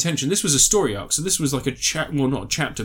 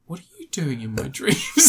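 unvoiced, menacing whisper right behind; creepy whisper. Blonde pervert creeps up on intruder, creepy whisper. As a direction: breathe it like a stalker at his ear. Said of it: "comes up behind him and goes".

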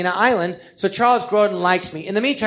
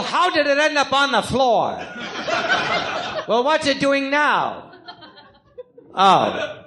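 Eddie Spomoso; 6 semitones higher than usual. Acted. Gangster stuck on the phone with ma, exasperated. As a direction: humour Ma, then wail to get off the phone. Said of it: "Eddie Spomoso, sorry, a gangster".

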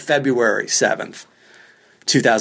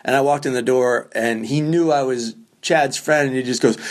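ordinary talk

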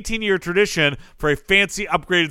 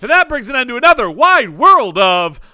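announcer voice; 8 semitones higher, 4 dB louder than usual. Acted. Closing the show segment.

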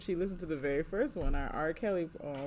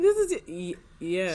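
flustered stammer; 2 semitones higher, 9 dB louder than usual. Retold or imitated. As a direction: stumble out the answer in shock. Caught off guard, stammering.

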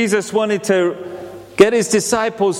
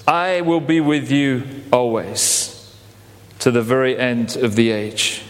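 solemn promise; 6 semitones lower than usual. Retold or imitated. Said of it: "He says".

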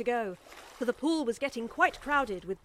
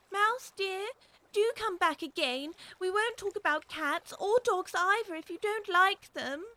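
gentle coaxing call, higher; calling softly; 8 semitones higher than usual. A narrator's reading. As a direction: coax a frightened pet back. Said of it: "she called softly after it".

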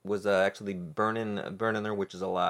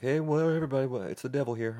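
devil voice; 6 semitones higher, faster than usual. Pretend. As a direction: ham up a chatty devil. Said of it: "I'm going straight into the devil voice".

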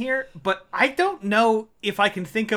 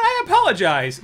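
fake apology; 5 semitones higher than usual.

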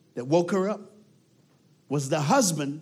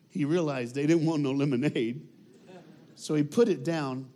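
ordinary delivery

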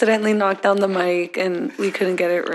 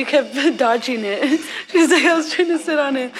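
higher-pitched complaint through giggles; laughing mock complaint; 6 semitones higher than usual. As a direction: imitate her protest while cracking up. Voicing the woman's complaint while laughing.

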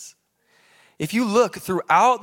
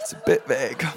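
laughing; 6 semitones lower than usual. Retold or imitated.

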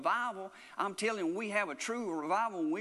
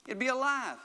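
raised, higher-pitched preaching cry; emphatic exclamation; 7 semitones higher, 3 dB louder than usual. Real, not acted. Preacher driving the point home to the congregation, emphatic exclamation. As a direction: proclaim it high and emphatically.

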